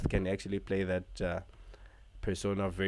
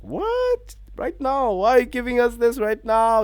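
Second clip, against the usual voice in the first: much higher, louder squeal of surprise; shocked disbelief; 10 dB louder than usual. Retold or imitated. Fan reacting to Seth Rollins showing up, high shocked yelp. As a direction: replay his own stunned reaction. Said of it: "I'm like".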